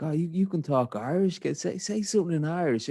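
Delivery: high, eager tourist voice; lightly voiced excited travellers; eager backpackers. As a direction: play the keen foreigners begging for Irish. Hostel travellers asking eagerly.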